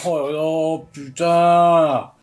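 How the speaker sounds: loud, drawn-out amazement; real wowed outburst; awestruck swearing. Real, not acted. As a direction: swear in awe at a stunning sight. Blown away by a shot.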